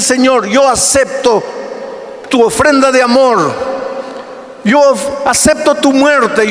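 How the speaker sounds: fervent pulpit cry building up; full-force preaching. Acted. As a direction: preach it out, lifting the voice toward the prayer's climax.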